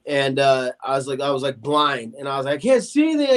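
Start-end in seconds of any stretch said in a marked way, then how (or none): 2.6-3.3 high, loud panicked cry. Retold or imitated. Blinded man crying out in his kitchen, panicked and loud. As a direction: call it out in rising panic, pitched up.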